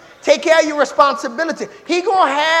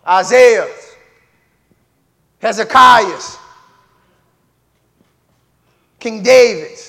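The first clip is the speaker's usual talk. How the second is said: preacher calling names; 3 semitones lower, 8 dB louder, slower than usual. Real, not acted.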